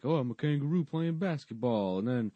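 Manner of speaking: high, hammed-up play-along voice; imitating Mark Curry. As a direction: play along broadly for the kids. Acts Mark Curry playing kangaroo.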